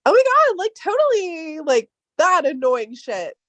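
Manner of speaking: put-on high, loud Valley Girl gush; mock her younger Valley Girl self, very high; Valley Girl accent. Young woman imitating her old Valley Girl accent, high and loud. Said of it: "your Valley Girl accent is so extreme".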